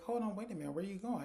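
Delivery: high-pitched, insistent halt; retold calling back. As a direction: imitate a mother stopping someone slipping away. Mother stops man trying to leave.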